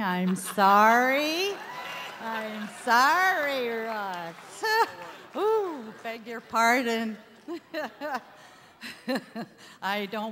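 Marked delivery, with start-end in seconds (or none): 0.0-4.6 louder, slow, sheepish sorry; embarrassed apology. Real, not acted. Corrects herself after naming show wrong. 4.7-5.3 a quick high giggle; embarrassed giggle. Real, not acted. Laughs at her own slip. 5.4-7.2 playful, louder beg your pardon; mock-polite apology. Real, not acted. Mock-polite after her slip. 7.5-8.5 a short high giggle; light giggle. Real, not acted. Still laughing at the slip.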